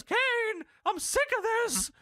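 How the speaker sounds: put-on high, strained old-man squeak; hammed-up high Paul Bearer whine; squeaky Paul Bearer voice. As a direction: squeal it like a pathetic old ghoul. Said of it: "Paul Bear says".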